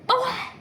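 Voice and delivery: sudden high, loud "oh"; abrupt high yelp, mimicking a sudden blow; imitated slap sound. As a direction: burst out with a short, high cry.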